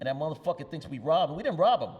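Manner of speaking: mock outrage, rising to the last line; indignant protest. Ven insisting on the phone they didn't rob him, indignant.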